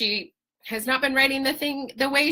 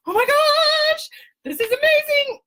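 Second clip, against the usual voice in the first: very high, loud, overjoyed; thrilled squeal; 6 dB louder than usual. Retold or imitated. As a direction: squeal it out, drawing out the gosh. Retold thrill at phone news.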